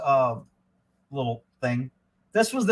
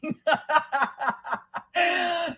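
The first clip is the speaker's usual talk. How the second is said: high, steady cackle; long high-pitched laugh; real laughter; 10 semitones higher than usual. Real, not acted. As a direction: crack up at a joke. Laughs at meme.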